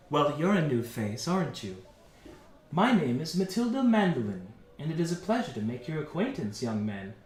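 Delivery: a hint of a poised minstrel introducing herself; understated courteous bard voice, falling away low at the end; lightly voiced welcoming bard. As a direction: softly greet a newcomer, lightly in character. Game master voices the bard woman.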